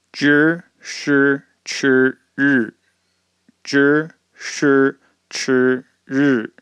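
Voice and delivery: 知识吃日 read at half speed, each syllable drawn out; slow native reading. Shown as a demonstration. 老师慢慢地读“知识吃日”. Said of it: "some examples of these sounds".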